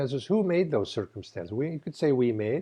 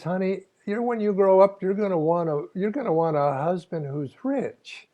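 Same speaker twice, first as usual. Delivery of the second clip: higher-pitched Texas mother's voice; Texas accent; 7 semitones higher, 4 dB louder than usual. Acted. Practical mother giving her teenage girl husband advice, Texas accent. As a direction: advise a daughter in a Texas accent. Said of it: "say this with a Texas accent".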